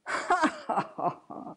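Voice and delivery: high, airy chuckle in a falling run; gloating laugh. A narrator's reading. Sexton alone by the grave, gloating laugh. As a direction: laugh to yourself at your own grim joke. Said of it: "He laughed as he set himself down".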